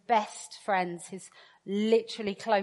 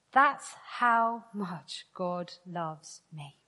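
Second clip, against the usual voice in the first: soft, slow and sincere; tender and slow; 3 semitones lower, 4 dB quieter, much slower than usual. Real, not acted. Preacher telling the church of God's love, tender and slow. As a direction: lay it down gently, slowly.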